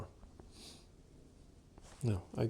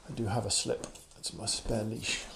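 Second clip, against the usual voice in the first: normal talking voice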